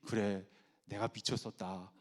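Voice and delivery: low, slow, soft surrender; chastened husband. Retold or imitated. Husband conceding to scolding wife, subdued and chastened. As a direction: give in meekly.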